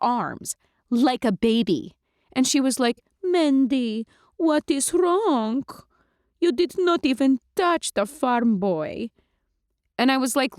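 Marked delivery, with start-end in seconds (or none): 3.2-9.1 higher, slow, puzzled; vampire Lulu, accented. Acted. Lulu asks Mandy why she skipped dinner, accented and puzzled. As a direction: ask like a doting old-world vampire. Lulu worried, holding cat.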